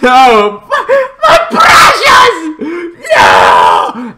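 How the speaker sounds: hammed-up despairing yell, no-o; anguished shout with a laugh. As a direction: wail for your precious creation. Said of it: "he is like".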